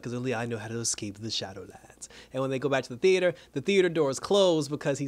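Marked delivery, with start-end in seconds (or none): 0.0-2.1 lower, softer, menacing villain voice; the Shade ominous. Retold or imitated. The Shade warning Pat about the Shadowlands, low and hushed. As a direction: sink the voice low and quiet like a threat. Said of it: "He's like".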